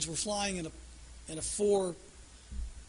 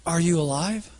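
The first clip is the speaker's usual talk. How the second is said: loud anxious call, Are you alive; shouting down to check he lives; 8 dB louder than usual. Retold or imitated. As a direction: call out to a man on the ground. People calling to fallen man.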